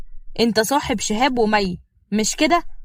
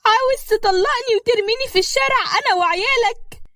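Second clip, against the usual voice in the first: much higher, whiny and put-on tearful; a wronged wife laying it on thick; fake tearful wife; 13 semitones higher than usual. Mai complaining to her father-in-law, high fake-tearful whine.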